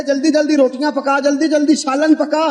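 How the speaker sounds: high-pitched, urgent retold command; urgent pleading. Husband hurrying his wife to cook for the hungry Prophet, urgent. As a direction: urge her on in a high, insistent preacher's voice.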